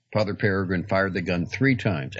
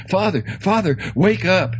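high, loud call to wake him; urgent call; 13 semitones higher, 8 dB louder than usual. Acted. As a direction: shout him awake. Urgently waking the sleeping priest.

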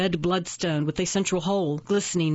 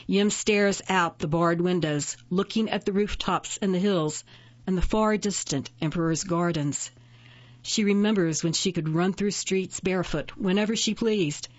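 ordinary delivery, nothing marked

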